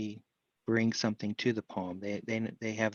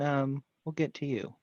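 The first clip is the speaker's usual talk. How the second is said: usual speaking voice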